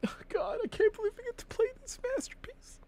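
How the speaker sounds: high, fast, breathless praise; sarcastic gushing. Pretend. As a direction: gush mockingly over the game. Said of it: "I'm so emotional playing Riven".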